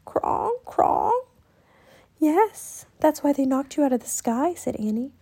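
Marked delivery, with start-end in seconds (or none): 0.1-1.1 high, ringing bird call; gentle high raven sound; soft bell-like croaks. A narrator's reading. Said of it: "Rock made soft bell-like sounds". 2.2-4.5 put-on higher child voice; reply kindly to a bird; gentle girl. A narrator's reading. Annie answers the raven gently.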